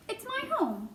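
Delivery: high-voiced little dignity; dignified. A narrator's reading. Mouse tells the boy of his home, dignified. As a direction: state it high, standing on dignity. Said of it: "said Ralph with dignity".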